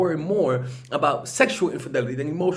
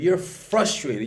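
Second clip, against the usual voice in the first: ordinary delivery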